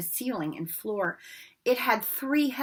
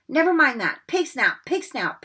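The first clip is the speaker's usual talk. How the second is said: breathless, louder hurried password; panting; 5 semitones higher, 6 dB louder than usual. A narrator's reading. Harry panting the password to the fat lady. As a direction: gasp out the password. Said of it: "panted Harry".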